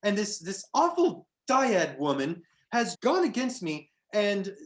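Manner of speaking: a wronged fey jester, loud and indignant, begging travelers; satyr jester. Acted. GM voices the satyr Lou.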